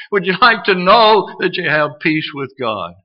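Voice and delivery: ordinary talk, nothing marked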